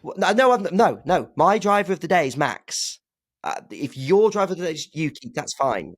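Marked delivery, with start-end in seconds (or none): 0.0-3.0 flustered protest. Real, not acted. Protesting a stolen pick.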